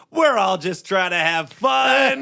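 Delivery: high, slow, jolly party voice; hammed-up folksy host; mock cheery relative. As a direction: play a dismissive uncle at a cookout. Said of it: "And I was like".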